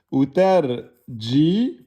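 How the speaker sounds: "Ou tarjimu" drawn out slowly with a rise; teacher reading the pupil's version; slow careful reading. Shown as a demonstration. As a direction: read "Ou tarjimu" slowly off the page. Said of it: "ils l'ont écrit comme ça".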